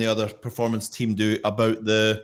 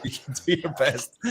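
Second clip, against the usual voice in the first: laughing; 3 semitones higher than usual. Real, not acted. Host laughs at guest's reply.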